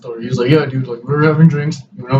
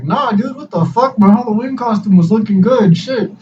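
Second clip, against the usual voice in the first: much higher, offended denial; indignant protest; 8 semitones higher than usual. Retold or imitated. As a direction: squeak out the outrage at the insult. Indignant about his bloody face.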